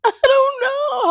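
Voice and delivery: high, loud, laughing through the words. Real, not acted. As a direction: laugh at yourself while repeating it. Laughs at own childhood crying.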